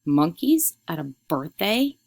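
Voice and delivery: slow, drawn-out scornful question; pompous, incredulous scoff; pompous mother. A narrator's reading. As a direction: stretch it out in haughty disbelief. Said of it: "pompously put her hands on her hips".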